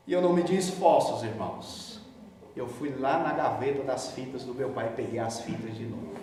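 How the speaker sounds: ordinary delivery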